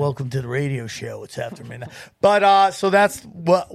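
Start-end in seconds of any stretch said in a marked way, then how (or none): 0.0-1.9 late-night DJ voice. Pretend. Mock late-night radio DJ.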